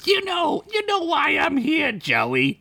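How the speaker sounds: high squeaky gangster voice; menacing Crinkles. Acted. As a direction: lean in like a tiny crime boss. Crinkles threatens Joey.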